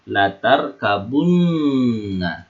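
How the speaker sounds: slow recitation, lingering on "tarkabunna"; gunnah. Shown as a demonstration. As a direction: stretch the nn in "tarkabunna" as a hum. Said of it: "maka dibaca apa? Gunnah".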